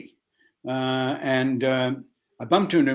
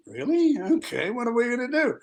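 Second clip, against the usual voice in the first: high, eager surprise; 10 semitones higher than usual. Retold or imitated. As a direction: sound thrilled by the offer. His own eager reaction retold.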